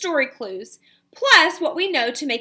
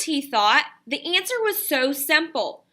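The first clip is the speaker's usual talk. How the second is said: ordinary delivery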